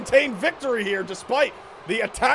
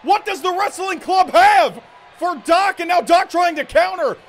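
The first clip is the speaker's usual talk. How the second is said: loud, higher urgent commentary; excited shout; 6 semitones higher, 4 dB louder than usual. Real, not acted. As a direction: call the chaos as it erupts. Surprise ambush, live call.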